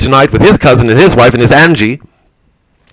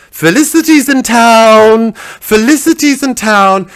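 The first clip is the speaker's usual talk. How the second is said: high, slow, drawn-out call; self-announcing call; 8 semitones higher, slower than usual. Acted. Felicity arrives in Edinburgh in her carriage, high drawn-out calling. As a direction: herald your own arrival, stretching it out high.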